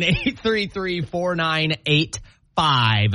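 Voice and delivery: very slow, each digit spaced; slow number read. Acted. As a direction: spell out the number slowly for callers. Repeating the number slowly.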